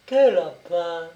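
slow, drawn-out feigned innocence; playing dumb, trembling. A narrator's reading. Peasant woman feigning ignorance to the brigadier, uneasy. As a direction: act puzzled while nervous. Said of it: "elle tremblait".

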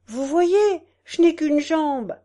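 high, loud complaint; crippled man. A narrator's reading. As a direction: call out your misfortune from the crowd. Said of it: "dit un estropié".

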